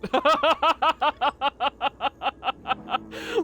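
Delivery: long, high cackling giggle; laughing. Real, not acted. Player cracking up at mermoos' teasing, laughing. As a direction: laugh helplessly till it trails off.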